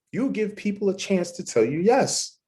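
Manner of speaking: ordinary talk, nothing marked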